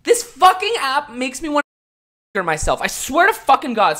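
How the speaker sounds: high, loud, fed-up outburst; furious, strained rant about the app; exasperated rant. Real, not acted. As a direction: vent like you've had enough. Ranting at TikTok commenters.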